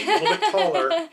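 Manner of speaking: words squeezed out high through laughter; laughing. Real, not acted. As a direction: crack up on the punchline. Laughing at Jetsons pollution joke.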